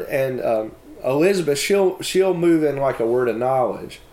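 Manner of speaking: ordinary delivery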